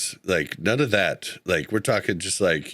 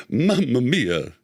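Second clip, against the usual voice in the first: slow, drawn-out mock Italian accent; half-hearted Italian caricature, slow; bad Italian accent; 3 semitones higher, slower than usual. Shown as a demonstration. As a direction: attempt a stock Italian accent, stretched out. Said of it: "No, I can't do Italian".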